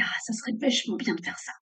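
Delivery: soft, hushed inner voice; quietly voiced intuition; petite voix intérieure. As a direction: lightly voice a little inner voice, softly. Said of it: "cette petite voix qui dit".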